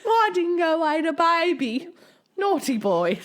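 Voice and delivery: high, loud, slow mock Australian accent; drawn-out hammed-up Australian accent. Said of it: "how they try and do an Australian accent".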